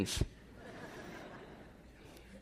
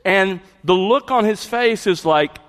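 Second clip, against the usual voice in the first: usual speaking voice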